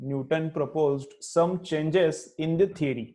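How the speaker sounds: slow, measured classroom dictation. Shown as a demonstration. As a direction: dictate phrase by phrase for students writing it down. Teacher dictates notes to students.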